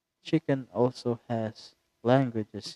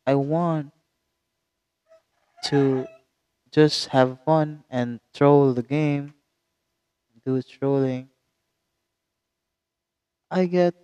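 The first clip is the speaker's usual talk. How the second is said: louder, higher gleeful build; 3 semitones higher, 6 dB louder than usual. Real, not acted. Gamer gleefully explaining how trolling gets good teammates. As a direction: tell the trick with glee.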